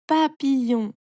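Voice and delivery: "papillon" as pa, pi, llon; three clear syllables in "papillon". A narrator's reading. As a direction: stretch "papillon" into three beats. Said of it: "tu coupes le mot en petites parties".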